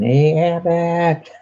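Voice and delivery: high, slow drawn-out call of Hey Abbott; stretched comic shout at a partner; Costello call. Retold or imitated. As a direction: holler it like Lou Costello. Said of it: "Who's on First?".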